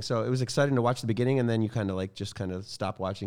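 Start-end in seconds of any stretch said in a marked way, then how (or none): none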